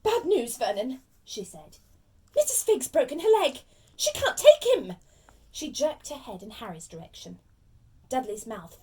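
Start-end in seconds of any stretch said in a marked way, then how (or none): 0.0-1.0 loud, agitated aunt with bad news; angry and worried. Aunt breaking bad news to her husband, angry and worried. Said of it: "looking both angry and worried". 2.4-4.9 high, loud agitated complaint; angry and worried. Acted. Aunt telling her husband the sitter is hurt, angry and worried. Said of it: "looking both angry and worried".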